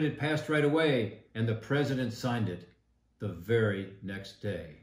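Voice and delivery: usual speaking voice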